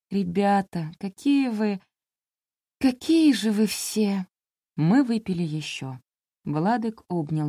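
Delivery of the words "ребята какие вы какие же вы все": slow, tipsy and moved; gushing to her new friends; touched toast. Acted. Девушка растроганно обращается к друзьям, медленно и с чувством.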